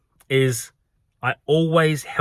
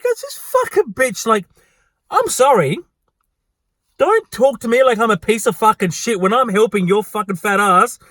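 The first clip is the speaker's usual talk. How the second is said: high-pitched exasperated venting; indignant outburst; 6 semitones higher than usual. Retold or imitated. Worker venting about a rude customer, indignant. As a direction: let the outrage spill out, voice raised in pitch.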